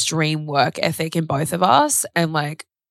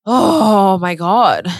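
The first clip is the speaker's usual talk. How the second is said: slow, louder, drawn-out awe; stunned; 3 semitones higher, 7 dB louder, much slower than usual. Retold or imitated. Slow, awed reply to the therapist's words. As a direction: let the realisation land slowly. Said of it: "And I was like".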